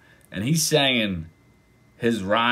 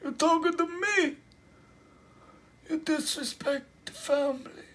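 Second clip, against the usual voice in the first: mafia Don. Acted. Don addresses someone who disrespected the family, mock mobster menace. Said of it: "saying, telling people, "I'm a Don".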